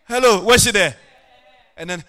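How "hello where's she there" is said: loud, higher-pitched calling out; impatient demand. Retold or imitated. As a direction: mimic someone demanding to know where she is. Acts out impatient demand while waiting.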